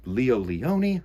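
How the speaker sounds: plain reading voice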